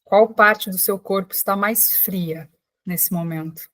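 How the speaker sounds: unhurried calming voice with long pauses; guided meditation. Real, not acted. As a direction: guide listeners gently into breath and body, leaving space after each phrase. Leads a guided breathing meditation.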